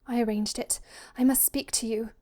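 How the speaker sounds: breathy urgent daughter, lightly done; a slightly gasping, confiding voice; gasped confession. A narrator's reading. Daughter alone with the visitor, urgent and gasped. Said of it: "she gasped".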